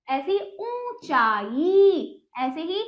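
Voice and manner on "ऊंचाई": ऊंचाई very slow, each vowel held; drawn-out model pronunciation of ऊंचाई; nasal vowel demo. Shown as a demonstration. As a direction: stretch ऊं, चा and ई in ऊंचाई. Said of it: "इसमें मुख और नाक दोनों का जोर".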